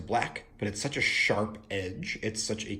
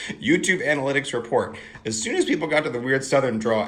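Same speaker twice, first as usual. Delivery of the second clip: loud mock news-report voice; mock announcer; 4 semitones higher, 7 dB louder, faster than usual. Vlogger joking about viewers leaving, announcer style. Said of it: "mock report".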